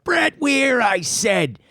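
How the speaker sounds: high, held cry falling at the end; catchphrase demand. Retold or imitated. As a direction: hold the name high, then drop down. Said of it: "the line, that it was even used".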